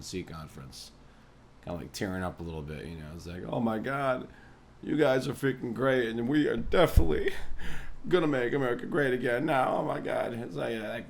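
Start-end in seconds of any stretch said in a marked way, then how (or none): 3.5-10.3 higher, louder mock-tearful Trump gush; overdone emotional thank-you. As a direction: gush like a teary showman thanking his crowd. Mocking Trump's tearful speech.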